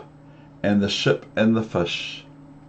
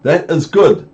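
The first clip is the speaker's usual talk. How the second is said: higher, louder fish voice; pleased fish; 6 semitones higher, 7 dB louder than usual. Acted. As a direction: chirp approval. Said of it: "said the fish".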